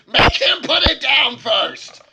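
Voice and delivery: high, loud, slow yelled order; shouting demand. Acted. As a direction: bellow it at them to drop it. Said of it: "is being like".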